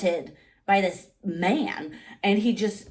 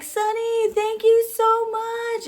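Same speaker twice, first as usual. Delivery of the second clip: much higher, slow, drawn-out call; calling out thanks; 5 dB louder, much slower than usual. Retold or imitated. Fan calling to the singer led off by security, high drawn-out call. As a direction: call after him as he's taken away. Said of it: "I'm just like".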